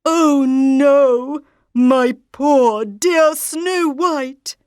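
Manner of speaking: slow loud grieving father; grieving king. Acted. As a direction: mourn his daughter. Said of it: "said the king".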